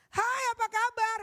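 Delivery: sapaan berteriak jauh lebih tinggi dan keras; teriakan salah. Shown as a demonstration. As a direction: teriakkan sapaannya seperti di lapangan. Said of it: "Bukan seperti itu". (sapaan berteriak keras dan tinggi)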